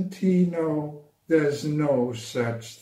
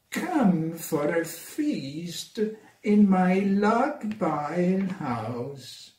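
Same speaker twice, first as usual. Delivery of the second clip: slow, drawn-out snake voice; sly snake tempting the mouse; slithery snake; 4 semitones higher, slower than usual. Acted.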